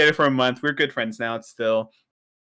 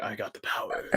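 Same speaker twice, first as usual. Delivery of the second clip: soft, lower, whispered villain line; Thanos; 5 semitones lower, 12 dB quieter than usual. Retold or imitated. Joking about his friend as Thanos, whispered. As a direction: breathe it like a smug supervillain. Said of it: "pulling a Thanos, just like".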